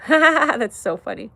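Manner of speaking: laughing. Real, not acted. Laughing at a chat joke.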